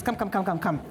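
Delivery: ordinary delivery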